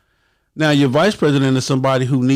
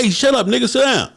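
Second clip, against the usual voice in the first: higher, barking cop order; mock police; 12 semitones higher than usual. Police order a member down: high barking mock order. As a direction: bark it like a cop. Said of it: "the motherfucking police say".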